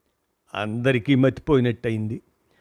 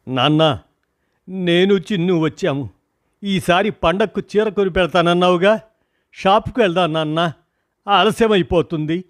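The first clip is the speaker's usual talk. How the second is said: daughter calling her father loudly; 6 semitones higher, 3 dB louder than usual. A narrator's reading. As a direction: raise the voice to wake him. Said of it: "అని గట్టిగా అరిచి చెప్పింది".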